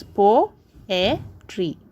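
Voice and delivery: "Poetry" with pauses between syllables; three separate syllables. Shown as a demonstration. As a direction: say "Poetry" beat by beat. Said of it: "three syllables".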